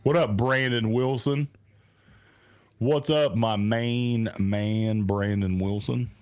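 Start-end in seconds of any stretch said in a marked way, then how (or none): none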